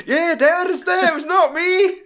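high, slow mock whine; caught schoolboy. Son blaming the others to dad, schoolboy whine. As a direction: imitate a boy wailing his excuse to dad. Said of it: "like a bunch of naughty schoolboys being caught".

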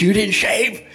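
mock disbelief from an inspecting officer; indignant officer. Officer catches recruit unshaven again.

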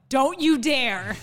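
loud, slow, drawn-out command; coach's warning. Retold or imitated. Coach catches rower slowing down, loud warning shout.

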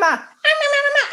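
extremely high squeaky babble; tiny playback gibberish; high-pitched toy hamster. Acted. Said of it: "plays it back really high-pitched".

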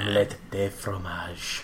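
French chef. Pretend. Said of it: "a little French".

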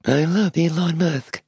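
high, slow robotic monotone; programmed devotion, slow and high; emotionless robot. Pretend. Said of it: "We could pretend we're robots".

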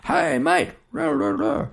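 high-pitched distant call; calling across distance. Acted. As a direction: imitate someone hollering over water. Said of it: "someone could be saying".

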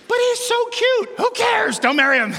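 high, squealing mock friend; mock girly voice. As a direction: pipe it up high like a gushing girlfriend. Mock advice voiced in a woman's voice.

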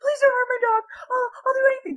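very high, mock-panicked pleading; freaking-out old lady. Old lady begging pranksters to spare her dog, high panicked plea. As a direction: beg like a frantic old lady. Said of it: "she's freaking out".

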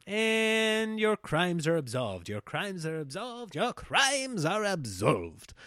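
slow, solemn proclamation; Old English decree. Retold or imitated. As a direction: intone it like a royal decree. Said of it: "start speaking in Old English".

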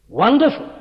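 Wonderful rising high and loud; emphasized word. Shown as a demonstration. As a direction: lift Wonderful up with awe. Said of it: "I do want to emphasize this word wonderful".

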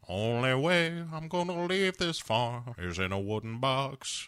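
put-on drawling, defiant country old-timer; Uncle Jesse. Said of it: "And Uncle Jesse says".

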